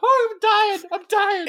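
high, laughing through the words; real helpless laughter; dying of laughter. Real, not acted. Host cracking up at his cohost, high laughing voice.

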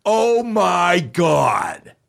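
imitated high, long-stretched exclamation; aghast outcry. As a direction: draw every word out like an appalled man. Retold outburst of offended man.

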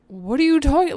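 loud, high retold outburst of disbelief; exasperated protest. Girlfriend answering his repeated demand, loud baffled protest. Acted louder reply to repeated ultimatum.

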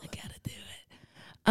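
slow, breathy whispered character line; whispered impression. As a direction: whisper it, still in the Baldwin voice. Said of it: "why did I make that his voice?".

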